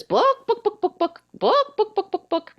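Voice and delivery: high squawky bawk bawk of a chicken; mock chicken clucking, book book. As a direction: cluck it out like a hen. Chicken in joke says book.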